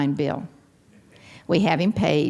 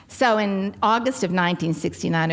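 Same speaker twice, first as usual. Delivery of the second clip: ordinary talk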